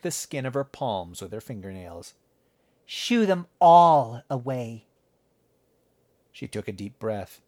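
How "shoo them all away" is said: slow, loud, icy order; cold command. A narrator's reading. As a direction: order it slowly with cold fury. Girl's cold angry command.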